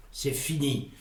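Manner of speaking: ordinary delivery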